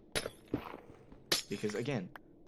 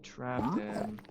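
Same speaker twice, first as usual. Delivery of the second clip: gloating; 11 semitones higher than usual. Real, not acted.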